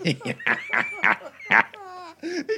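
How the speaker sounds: helpless high laughter. Real, not acted. Host cracking up at his friend, high laugh.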